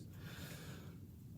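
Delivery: soft unvoiced stressful chest breath; chest-breath sigh demo. Shown as a demonstration. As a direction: show a shallow, tense breath through the chest. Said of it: "and you'd do this".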